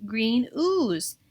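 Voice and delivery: ordinary delivery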